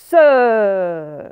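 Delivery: loud, drawn-out hiss; snake hiss. Acted. Pickle scaring Madame E, loud snake hiss. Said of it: "il imite le serpent".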